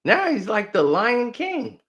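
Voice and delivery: ordinary delivery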